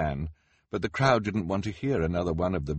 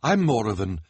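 higher, louder nervous excuse; uneasy, self-important protest; 6 semitones higher, 6 dB louder than usual. Acted. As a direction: excuse yourself grandly but uneasily. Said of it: "said nervously to the crowd".

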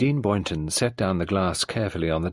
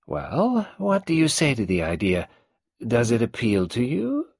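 put-on higher, creaky old matriarch voice; old tyrant mother; 4 semitones higher than usual. Old Mrs Boynton greeting Nadine, in her higher old-woman voice. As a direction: play the domineering old woman.

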